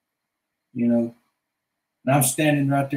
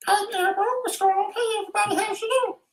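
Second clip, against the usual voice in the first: much higher squeaky voice; very high cartoon greeting; faster than usual. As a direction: squeak hello to everyone.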